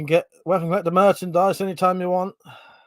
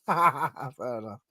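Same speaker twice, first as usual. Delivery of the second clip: sarcastic; 3 semitones lower, much slower than usual. Shown as a demonstration. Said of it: "I was just being sarcastic".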